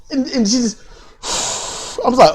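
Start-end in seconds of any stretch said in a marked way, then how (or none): 1.2-2.0 long, voiceless huff of breath; exasperated huff. Retold or imitated. Imitating Cedra upset after the kickout, exasperated sigh. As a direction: blow out a hard, wordless sigh.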